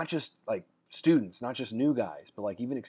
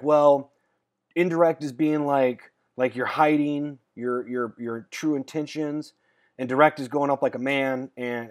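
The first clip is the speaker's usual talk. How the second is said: louder, slow, drawn-out mock answer; groping explanation; 4 semitones higher, 7 dB louder, slower than usual. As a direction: imitate a coach slowly working out his answer. Acting out coach's groping answer.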